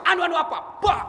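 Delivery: high-pitched echo of a stammer; mock angry retort. Questioner challenges rich man over his car, high mock angry retort. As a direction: throw the hesitation back, voice leaping up.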